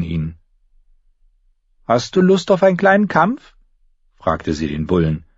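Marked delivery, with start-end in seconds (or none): 1.9-3.6 put-on high girlish voice throwing out a challenge; high-voiced cheeky dare; young girl Arya, challenging. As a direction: pitch it high, like a feisty girl.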